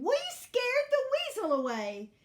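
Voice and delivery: high, loud, jubilant hen voice; triumphant. A narrator's reading. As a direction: crow about the win. Hens celebrate their victory.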